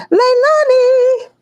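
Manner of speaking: high, slow, drawn-out call across the house. Real, not acted. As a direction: call the name out long and high. Calling out to someone.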